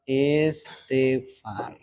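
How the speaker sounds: "Istighfar" soft, low, very drawn out; quiet dictation of "Istighfar" with gaps between syllables; slow model answer. Shown as a demonstration. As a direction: spell out "Istighfar" slowly in three syllables. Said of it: "Menjadi apa".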